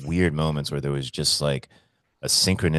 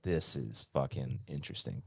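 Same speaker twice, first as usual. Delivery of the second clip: hushed aside; 4 semitones lower, 13 dB quieter than usual. Retold or imitated. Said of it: "And I was like".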